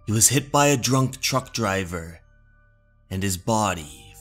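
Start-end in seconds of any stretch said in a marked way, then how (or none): none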